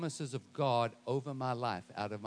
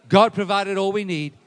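loud, raised preacher's proclamation; forceful declaration; 5 semitones higher, 13 dB louder than usual. Shown as a demonstration. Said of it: "say it like this".

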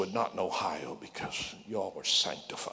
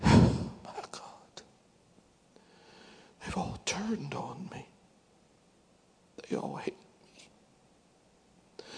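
despairing whisper; 7 semitones higher, slower than usual. Acted. David despairs in the cave.

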